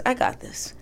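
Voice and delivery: whispered, quick aside; retold in an airy whisper; murmured to oneself, whispered; hushed self-assurance.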